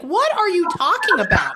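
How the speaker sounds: high-pitched mock disbelief; retold incredulity. Medium replaying her reply to his hookup remark, incredulous. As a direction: balk at an absurd answer.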